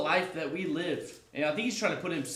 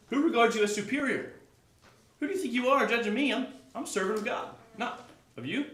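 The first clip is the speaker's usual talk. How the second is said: indignant rhetorical challenge; 8 semitones higher than usual. Acted. Paul confronting the puffed-up church, indignantly. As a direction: demand who they think they are. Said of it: "He says".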